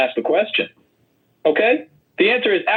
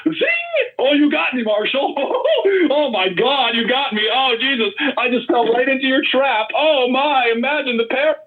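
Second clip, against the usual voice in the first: hammed-up fake astonishment, pitched way up; sarcastic mock surprise; 8 semitones higher than usual. Mocking Marshall's gotcha in a debate, sarcastic hammed-up shock. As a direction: overplay being caught out to mock him.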